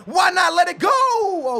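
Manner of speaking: loud high frustrated yell; shout. Real, not acted. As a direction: yell it out in disbelief. Excited commentary of own shot.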